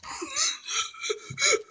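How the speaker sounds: high breathy whimpering sob; frantic whimper. Acted. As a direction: whimper in panic. Passenger breaks down.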